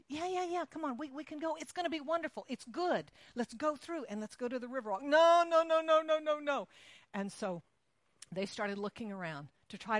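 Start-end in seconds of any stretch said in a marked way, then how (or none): 0.1-5.0 high, coaxing encouragement; coaxing aunt. Retold or imitated. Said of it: "And so she said". 5.0-6.7 loud, high panicked no's; a scared kid refusing to budge; panicked child. Retold or imitated. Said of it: "terrified child refusing elevator".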